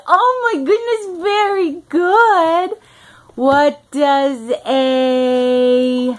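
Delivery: put-on high sing-song for a toddler; reading to a toddler. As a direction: coax the little one brightly. Mother quizzes toddler on animal sounds.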